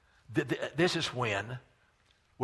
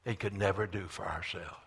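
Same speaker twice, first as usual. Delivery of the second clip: ordinary talk, nothing marked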